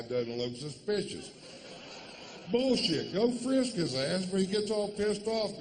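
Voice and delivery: ranting. Acted. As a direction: work yourself into an outraged frenzy. Comedic rant building up.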